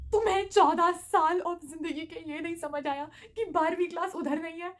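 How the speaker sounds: incredulous scolding. Retold or imitated. Vice principal scolds twelfth grader, loud disbelieving tone. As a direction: scold her for not knowing the school.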